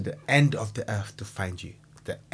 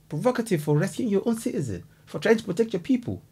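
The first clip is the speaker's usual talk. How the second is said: indignant; 7 semitones higher than usual. Real, not acted. Outraged rebuttal.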